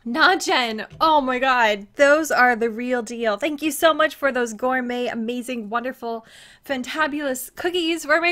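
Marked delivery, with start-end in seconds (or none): none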